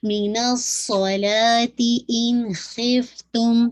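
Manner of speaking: من الصلاۃ ان خفتم very slow, ص whistled and heavy; slow tajweed recitation; whistling sad. Shown as a demonstration. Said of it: "صاد پہ whistling sound بھی ہے".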